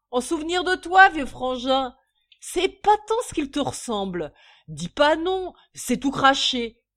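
loud, high, emphatic boasting; proud drunken gushing. A narrator's reading. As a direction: gush like a tipsy proud father. Drunk father proudly boasting of baby. (fierté exubérante d'ivrogne)

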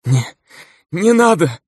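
high, desperate begging; terrified plea. A narrator's reading. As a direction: beg in sheer terror. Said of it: "выдохнул он, с ужасом". (в ужасе, умоляя)

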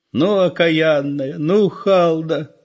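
high, slow grieving granny's wail; lamenting old woman. A narrator's reading. Old mother despairing over her daughter, high slow lament. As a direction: drag it out in dismay like an old woman. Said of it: "сокрушалась старуха".

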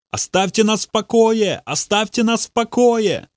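desperate high scream. A narrator's reading. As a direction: cry it out while falling to your knees. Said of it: "закричал Бобби".

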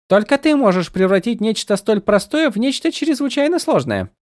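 high, light and teasing; a tiny sprite needling her friend; playful spren voice. A narrator's reading. Голос Сил. (высокий игривый голос духа)

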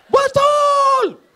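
very high, loud cry of agreement; shout. Real, not acted. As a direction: yell it out to whip up laughter. Jokes about provoking the wives.

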